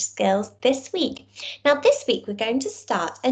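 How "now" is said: normal talking voice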